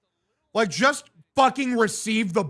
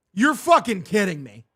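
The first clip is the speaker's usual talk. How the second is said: usual speaking voice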